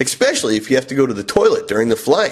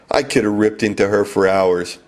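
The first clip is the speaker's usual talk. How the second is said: usual speaking voice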